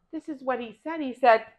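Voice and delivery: ordinary delivery, nothing marked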